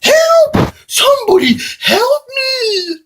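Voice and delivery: high, drawn-out distressed wail; acted damsel-in-distress cry; cry for help. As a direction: call out in high-pitched panic. Victim calls out for help.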